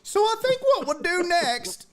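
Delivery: high, hooting oblivious voice; ditzy aloof aunt. Retold or imitated. Aunt eating at the window, ditzy and oblivious. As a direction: imitate her carrying on obliviously in a high voice. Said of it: "a little ditzy and aloof".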